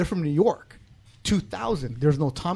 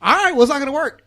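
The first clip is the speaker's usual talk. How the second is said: cocky retort; 9 semitones higher, 6 dB louder than usual. Retold or imitated. Retold retort to New Yorkers.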